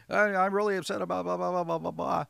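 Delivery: high, loud, fast upset rant; upset client. Shown as a demonstration. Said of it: "somebody's coming at me".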